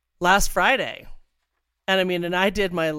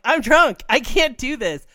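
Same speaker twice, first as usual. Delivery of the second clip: tipsy panic; 6 semitones higher, 5 dB louder than usual. Retold or imitated. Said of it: "I was like".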